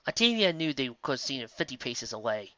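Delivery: ordinary delivery, nothing marked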